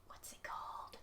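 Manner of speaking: hushed whisper. Real, not acted. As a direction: ask in a secret whisper. Whispered aside during reading.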